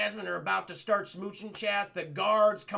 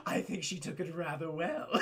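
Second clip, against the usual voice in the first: smug dry aside; 3 semitones lower, 5 dB quieter than usual. Retold or imitated. Jafar deadpans to Iago, teller amused.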